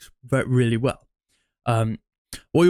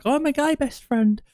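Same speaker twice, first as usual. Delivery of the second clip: much higher, simpering mock nice-guy voice; 13 semitones higher than usual. Nice guy mocked to the girl he likes, high and simpering. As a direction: ham up a needy friend-zoned guy, pitched way up. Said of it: "you'll be like".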